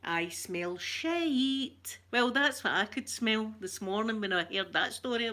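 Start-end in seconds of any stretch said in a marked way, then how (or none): 0.0-2.0 put-on very slow, drawn-out old lady sniff; wee Scottish lady. Imitating a TV sketch lady, slow and disdainful. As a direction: drag it out like a disgusted wee lady. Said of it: "and she'll go".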